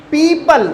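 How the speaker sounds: पीपल with a high, stretched first syllable; long stressed ee. Shown as a demonstration. Teacher reading an example, पीपल with the ee held long. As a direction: say पीपल as "peee-pul". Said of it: "stress दे के बोलना है".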